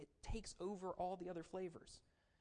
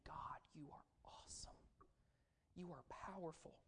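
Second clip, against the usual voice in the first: soft whispered praise to God; reverent whisper; 10 dB quieter than usual. Acted. David lifting his hands to heaven, whispering praise. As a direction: hush down and whisper it like a prayer.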